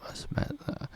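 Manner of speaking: breathy whisper to self. Shown as a demonstration. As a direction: whisper it under your breath. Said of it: "There, I just messed up".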